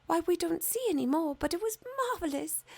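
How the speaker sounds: gossiping little birds; sparrow voices. Acted. Grey sparrows telling the fir about Christmas rooms, high and chattering.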